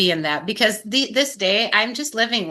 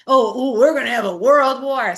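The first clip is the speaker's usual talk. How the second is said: higher mocking panic; mock alarm; 4 semitones higher, 4 dB louder than usual. Speaker mimics people fretting about war, mock alarm. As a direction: imitate a silly worrier.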